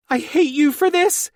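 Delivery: squealing way up high and loud; high-pitched squeal. A narrator's reading. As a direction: squeal it out in pain. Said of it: "in a high-pitched squeal".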